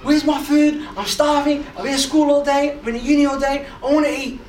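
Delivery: put-on loud, high-pitched hungry kid's complaint; acted cranky outburst; snapping at Mum. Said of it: "I crack at Mum. I snap at her".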